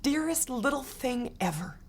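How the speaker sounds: high, soft grandma voice, fading to near hush; tender grandma. A narrator's reading. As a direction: reassure the child in your arms. Grandma hugs and comforts her.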